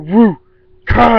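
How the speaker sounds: loud, high "woo" and explosive "ka"; whoop, then burst out the hit; imitated flip and impact. Showing a man flipping and hitting, loud sound effects. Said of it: "he went".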